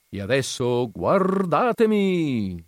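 high, very slow clown call. A narrator's reading. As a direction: draw it out like a clown. Clown calls attention.

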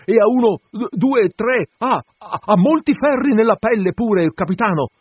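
very high, stammering harpooner's voice; excited, stuttering high-pitched cry; disjointed shouting. A narrator's reading. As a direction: blurt it in broken bursts, groping for the word. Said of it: "gridò in modo sconnesso".

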